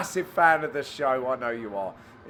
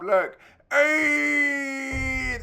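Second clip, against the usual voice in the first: high, long held "hey"; imitated crowd cheer; 8 semitones higher, 3 dB louder than usual. Commentator imitating the spectators at a great shot, long high cheer. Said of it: "that was the noise".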